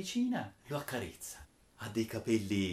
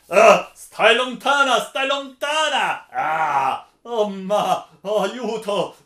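high, loud, drawn-out cries for help; terrified shout; 8 semitones higher, 15 dB louder, slower than usual. Acted. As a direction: scream to keep her away, panicked. Rinaldo fleeing Angelica in terror.

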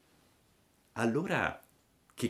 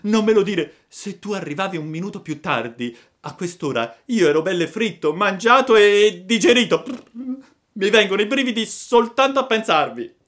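high-pitched, loud, fearful little voice; frightened puppet; 13 semitones higher, 10 dB louder than usual. A narrator's reading. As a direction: recount a narrow escape still full of fear. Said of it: "tremava ancora dalla paura". (burattino ancora impaurito, voce acuta)

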